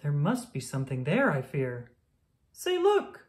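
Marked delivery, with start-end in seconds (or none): 2.5-3.3 much higher, bright exclamation; excited call. Acted. Friend spotting a bird in the ear, calling out higher. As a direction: point it out with sudden delight.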